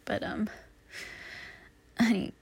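usual speaking voice

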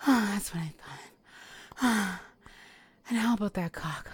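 usual speaking voice

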